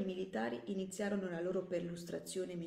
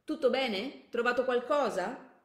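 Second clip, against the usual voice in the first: loud, higher check-in; brisk raised-voice soldier's question; calling from outside; 6 semitones higher, 9 dB louder, slower than usual. Acted. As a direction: call in to your comrade. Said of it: "la voce dell'altro militare richiamò l'attenzione".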